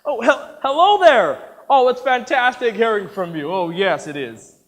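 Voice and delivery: high, loud, effusive hello; gushing phone greeting. Acted. Taking a call outside, over-delighted. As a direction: gush down the phone to the caller.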